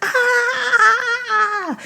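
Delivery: high cry of pain, half laughing; laughing-crying pain yelp. Retold or imitated. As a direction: yelp from sudden tooth pain. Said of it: "I was like".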